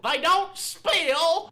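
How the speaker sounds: drawn-out loud high shout; loud slow exclamation. Acted. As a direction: hype up the product, loud and slow. Promo punchline shouted.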